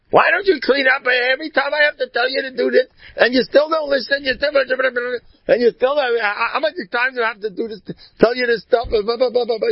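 high-pitched mock nagging tirade; yelling wife. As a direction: imitate a wife yelling at her husband, running into babble. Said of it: "yelling and at her husband".

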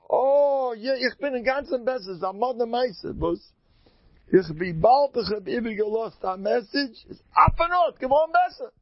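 amazed good news. Retold or imitated. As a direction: imitate an astonished man reporting his recovery. Acts out recovered man's amazed report. (נאכגעמאכטע פארוואונדערטע התלהבות)

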